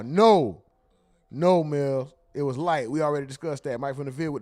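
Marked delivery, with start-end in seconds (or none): 0.0-2.1 emphatic denial. Real, not acted. Answering a donor's chat claim, a loud denial. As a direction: shout the no down at her.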